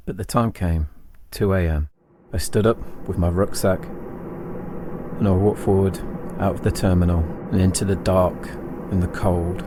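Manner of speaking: usual speaking voice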